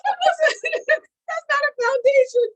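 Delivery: high, loud, helpless laughter in the words; laughing while talking. Real, not acted. Retelling the rouge mix-up to friends, real laughing speech. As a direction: squeeze the punchline out through laughs.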